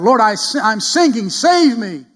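loud high desperate plea; desperate shout to the Lord for help; crying out. Acted. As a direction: cry out for rescue, loud and high. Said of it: "Peter cried out".

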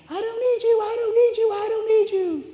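very high tiny voice; puny defiant squeak. Pretend. Tiny human defying God, very high squeaky mock voice. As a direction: squeak it like a tiny fist-shaker. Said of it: "shaking his puny little fist".

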